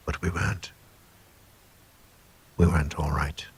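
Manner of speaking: hushed, ominous whisper. A narrator's reading. Narrator whispers the grim truth to listeners, hushed. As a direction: breathe it like a dark secret.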